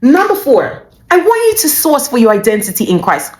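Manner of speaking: ordinary delivery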